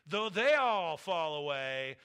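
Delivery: loud, slow, swelling declaration; bold boast. Acted. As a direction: proclaim it like a proud boast. Peter boasting loyalty to Jesus.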